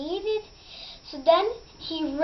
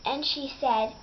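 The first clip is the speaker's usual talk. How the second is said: plain reading voice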